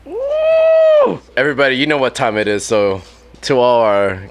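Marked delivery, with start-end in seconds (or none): 0.0-1.2 long loud high woo cheer; whoop. Real, not acted. Host cheering as the next song comes, loud whoop. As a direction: let out a big party whoop.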